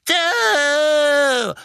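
long, high howl of hatred. A narrator's reading. Old woman recognising the man, howling. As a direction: wail the word out at full force. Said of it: "heulte sie".

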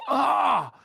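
high sudden exclamation; excited. A narrator's reading. Ron spots a figure moving ahead, excited. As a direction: burst out as you spot someone. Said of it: "sagte Ron aufgeregt".

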